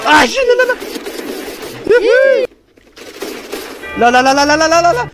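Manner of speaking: high loud whoops and no no; excited yelling. Real, not acted. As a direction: whoop then yell no in panic. Excited whoops then panic.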